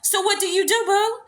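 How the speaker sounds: high-pitched, pointed follow-up question; questioning commenters. Acted. Commenters questioning the woman without dates, pointedly curious. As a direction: press her, ending high on the last word. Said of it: "y'all in the comments will be like".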